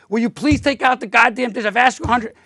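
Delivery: angry partner finally yelling about the dishes; fed-up demand. Retold or imitated. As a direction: snap at her after years of asking. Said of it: "months and years go by".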